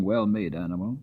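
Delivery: ordinary delivery, nothing marked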